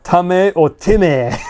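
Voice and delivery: "Tame" as TAH-meh, "Time" as TIM-eh; "Time" misread as tim-eh after "Tame" as tah-meh; Japanese-style readings. Shown as a demonstration. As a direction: slowly stretch "Tame" and "Time" into two syllables each. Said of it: "That's a little bit different".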